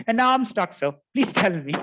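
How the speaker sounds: hammed-up junior begging his boss; stuck junior begs his mentor for help, mock pleading.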